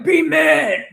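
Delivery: loud, high mock yell at the family. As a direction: shout it down at them, hammed up. Mother yelling at family.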